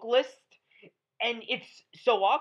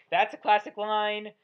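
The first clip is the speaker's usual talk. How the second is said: usual speaking voice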